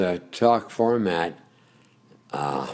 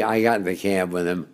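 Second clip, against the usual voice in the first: usual speaking voice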